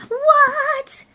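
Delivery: sudden thrilled high yelp; excited squeal. Real, not acted. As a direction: squeal with real delight at the card. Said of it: "sorry, I get excited".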